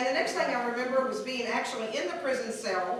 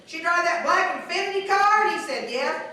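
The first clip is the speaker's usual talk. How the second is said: mock local guy; 5 semitones higher, 6 dB louder than usual. Tow guy describing the drunk woman, mock scorn.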